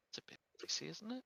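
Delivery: hushed, sly haggling; sly whisper. Acted. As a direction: whisper it slyly, then lift. Haggling aside with shopkeeper.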